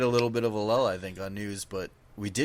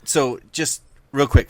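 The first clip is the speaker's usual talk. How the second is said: loud, high burst of wait wait wait; excited interruption; 8 semitones higher, 10 dB louder than usual. Real, not acted. Cutting in on his co-hosts, a loud, high, excited interruption. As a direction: cut in high and loud to stop the talk.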